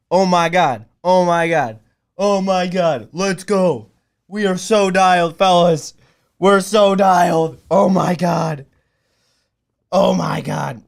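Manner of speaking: loud, elated exclamations; thrilled disbelief. Real, not acted. Streamer telling the fellas they are dialed, thrilled. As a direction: exclaim it loudly, still buzzing. Celebration coming down from screaming.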